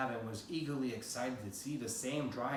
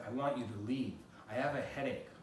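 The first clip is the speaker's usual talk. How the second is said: ordinary delivery, nothing marked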